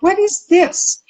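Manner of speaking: high, amazed question; surprised. Retold or imitated. To herself on noticing accents vanish, amazed. Amazed inner thought quoted.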